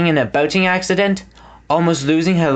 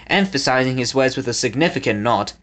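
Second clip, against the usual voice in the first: ordinary delivery, nothing marked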